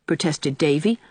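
ordinary delivery, nothing marked

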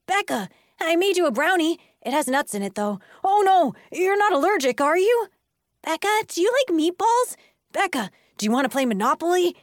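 high, loud, excited schoolkids; pushy fans, pitched high and loud; eager clamoring kids. A narrator's reading. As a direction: pepper her with overeager questions. Crowd of kids mobbing Becca.

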